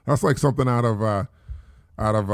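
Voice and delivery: ordinary delivery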